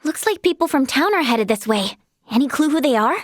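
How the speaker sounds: ordinary talk, nothing marked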